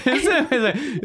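usual speaking voice

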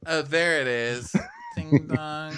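high ditzy impression; mock ditzy voice. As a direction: play her as clueless. Lisa rings doorbell.

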